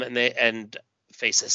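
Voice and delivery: usual speaking voice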